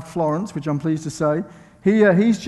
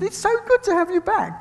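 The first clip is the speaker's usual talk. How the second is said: very high-pitched mock greeting; gushing welcome imitation; 14 semitones higher than usual. Visiting preacher mimics people welcoming them back, gushing high voice. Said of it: "a few people already to me said".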